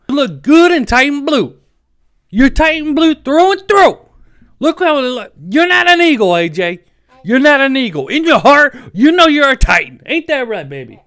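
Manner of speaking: loud, high pleading to the player; emotional plea. Real, not acted. Fan pleading with A J to return, loud and emotional. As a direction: beg him to come home, all heart. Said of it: "I get emotional about this".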